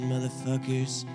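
ordinary talk, nothing marked

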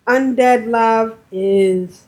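ordinary talk, nothing marked

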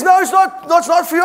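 high, loud, insistent no; firm refusal. Retold or imitated. Wife refusing her husband the check, high and insistent. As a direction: shut him down flatly and loudly.

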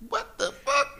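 high, stunned cry; shocked. Real, not acted. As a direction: react in total disbelief. Shocked reaction.

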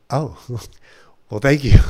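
retold relief, breaking into a laugh; relieved laughing thanks. Man expecting trouble thanks the commissioner, laughing in relief. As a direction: laugh out a surprised thank-you.

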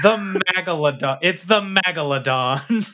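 loud, eager announcement; excited. Real, not acted. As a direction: blurt it out with glee. Loud joke name for the ship.

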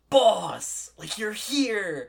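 high, loud burst of delight, then drawn out slowly; thrilled to see the boss walk back in; excited greeting. Acted. Employee greets returning boss, overjoyed.

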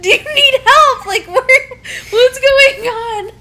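high, worried questions; high flustered onlooker asking if she's okay; concerned onlooker. Retold or imitated. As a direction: play the alarmed classmate checking on her. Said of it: "you'd be like".

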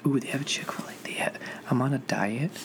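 soft excited whisper. Real, not acted. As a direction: whisper it like a guilty secret. Excited secret about Chick-fil-A.

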